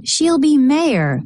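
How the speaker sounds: plain reading voice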